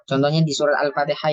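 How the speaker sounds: usual speaking voice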